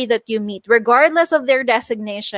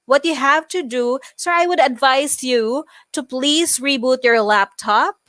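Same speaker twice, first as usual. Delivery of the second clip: "laptop" lifted like a question; unsure statement, voice climbing on "laptop"; rising inflection. Shown as a demonstration. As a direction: end the advice on a questioning rise at "laptop". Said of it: "if you always end in the rising tone".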